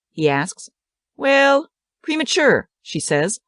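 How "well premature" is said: much higher, light correcting tone; playful correction. A narrator's reading. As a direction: lift it up playfully. Annie corrects the word.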